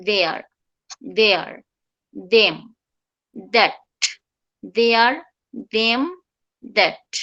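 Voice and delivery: there, them, that with dental TH, one by one; tongue between teeth. Shown as a demonstration. Teacher drilling TH words for learners, dental TH. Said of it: "জিহ্বাটা তখন দুই দাঁতের মাঝখানে চলে আসবে".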